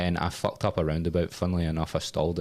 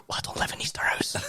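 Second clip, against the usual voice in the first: breathy whisper of disbelief; whispered retort; 4 dB quieter than usual. Retold or imitated. Answering the instructor, whispered and stunned. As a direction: whisper it, dumbfounded.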